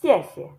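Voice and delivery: "Xiexie", loud falling first syllable; fourth then neutral tone. Shown as a demonstration. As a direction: hit and drop the first xie, clip the second. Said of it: "The fourth tone in this first syllable".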